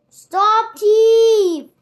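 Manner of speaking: loud, high shout after a thief. A narrator's reading. Crowd chasing a pickpocket, loud high shout. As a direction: yell it down the street. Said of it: "they shouted".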